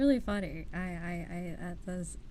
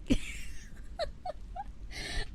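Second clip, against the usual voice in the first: breathless high-pitched giggle; high squeaky laugh. Real, not acted. Laughing at viewer side-eye.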